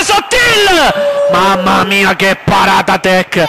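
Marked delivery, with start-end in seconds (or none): 0.0-1.3 excited shout. Real, not acted. Excited call of player's name.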